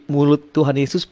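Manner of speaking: ordinary talk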